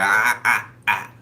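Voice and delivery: loud scolding spirit voice; loud mock telling-off. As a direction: call her out loudly to stop. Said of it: "Spirit was like".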